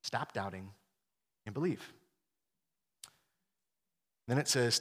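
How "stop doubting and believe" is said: soft, low gentle urging; quiet, reassuring appeal; kind invitation. Acted. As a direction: invite him tenderly, without demanding. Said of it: "It's a kind invitation to say".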